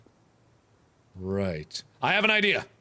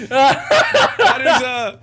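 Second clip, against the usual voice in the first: laughing; 5 semitones higher, 8 dB louder, much slower than usual. Real, not acted. Drawn out, loud laugh.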